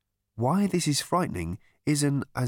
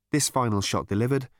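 ordinary delivery

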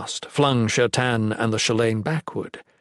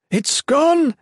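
high, loud cry of alarm; panicked exclamation; 5 dB louder than usual. A narrator's reading. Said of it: "Nolan exclaimed".

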